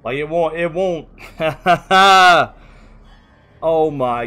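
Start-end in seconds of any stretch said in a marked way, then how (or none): 1.1-2.7 loud, helpless burst of laughter; exasperated laugh. Real, not acted. Streamer laughing as the stream falls apart, loud exasperated laugh. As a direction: laugh it off in disbelief.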